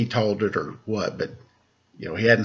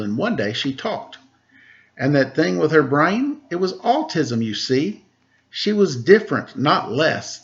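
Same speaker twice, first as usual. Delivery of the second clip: put-on bright, higher read-aloud voice for children; storybook narration; 6 semitones higher than usual. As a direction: read it like a picture book to kids. Reading a picture book aloud.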